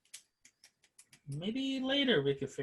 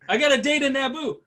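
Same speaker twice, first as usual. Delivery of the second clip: higher, louder whiny begging voice; pleading friend; 9 semitones higher, 6 dB louder than usual. Retold or imitated. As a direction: plead like a lovestruck buddy. Same begging friend quote.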